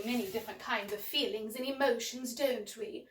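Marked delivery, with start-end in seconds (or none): none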